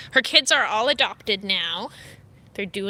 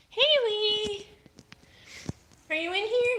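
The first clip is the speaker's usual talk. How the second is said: high, sing-song call to a hidden cat; 12 semitones higher than usual. Real, not acted. Owner calling the cat in a high coo.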